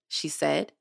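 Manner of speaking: ordinary delivery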